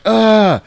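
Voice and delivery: high, loud cry; hammed-up shriek of horror; mock frightened scream. Brothers see the burned face, mock scream. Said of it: "And they're like".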